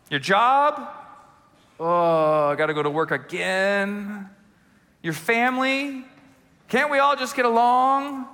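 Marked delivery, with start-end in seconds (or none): 1.8-4.3 mock grumbler. Voicing a grumbler's complaint. 6.7-8.3 mock grumbler. Voicing a grumbler's complaint.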